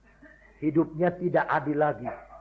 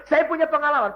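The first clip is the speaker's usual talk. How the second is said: mock boast; 9 semitones higher than usual. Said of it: "Berapa banyak orang Kristen bilang begitu".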